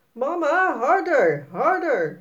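hoog aansporend geschreeuw, naar het eind wat zakkend; schreeuwen. A narrator's reading. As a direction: schreeuw mama vooruit. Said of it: "schreeuwt Sanne". (meisje schreeuwt mama aan in de race)

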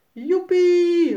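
high, loud joyful cheer. A narrator's reading. Children cheer at the promise of cake, loud and joyful. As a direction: whoop with delight. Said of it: "roepen Jasper en Sanne".

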